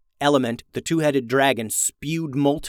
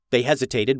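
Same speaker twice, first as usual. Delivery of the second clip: plain reading voice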